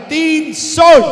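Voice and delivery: high, loud closing call; loud sold call. Real, not acted. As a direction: bang the gavel with your voice. Auctioneer closes the sale.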